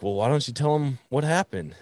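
high, knowing teasing prompt. Retold or imitated. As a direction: needle him to confess. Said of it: "Mark's like".

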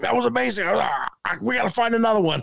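way up high, breathless with delight; ecstatic buddy. Retold or imitated. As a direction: squeal it like he can't believe it. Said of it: "he's having a total meltdown".

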